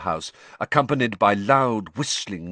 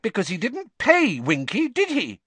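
put-on indignant girl's voice rising high and loud; rising shrilly; 13 semitones higher, 3 dB louder than usual. A narrator's reading. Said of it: "her voice rising shrilly".

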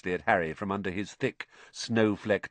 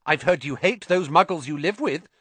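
higher-pitched quick comeback; swift retort; 8 semitones higher, 4 dB louder than usual. A narrator's reading. Ernie countering Harry at once, swift retort. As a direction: jump straight in with the accusation. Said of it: "said Ernie swiftly".

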